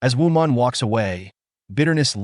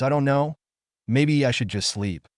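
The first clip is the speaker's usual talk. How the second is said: usual speaking voice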